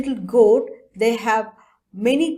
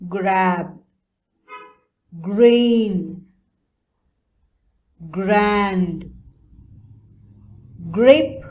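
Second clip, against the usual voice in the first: teacher modelling words; much slower than usual. Shown as a demonstration. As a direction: call out each word for the class to repeat. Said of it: "Can you say, children?".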